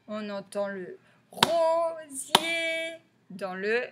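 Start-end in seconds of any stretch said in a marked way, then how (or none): 1.3-3.0 rosier higher, both syllables held long; drawn-out syllables. Shown as a demonstration. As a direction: sing out RO, SIER slowly. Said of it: "deuxième".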